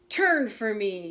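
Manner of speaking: the dark frog ordering Frog to turn the rope, loud; shouting dark frog. Acted. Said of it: "he shouted".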